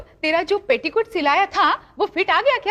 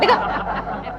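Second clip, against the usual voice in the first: ordinary talk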